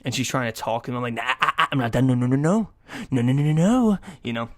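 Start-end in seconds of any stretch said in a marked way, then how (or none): 1.1-4.0 loud, rapid-fire string of no's; childish interrupting. Retold or imitated. Boyfriend cutting off girlfriend trying to talk, childish loud refusal. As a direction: drown them out with mock petulance. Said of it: "childish shit".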